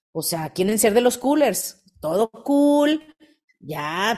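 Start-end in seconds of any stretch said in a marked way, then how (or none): 2.0-4.2 mock cool. Mocking the laid-back crowd.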